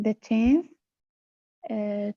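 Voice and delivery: ordinary delivery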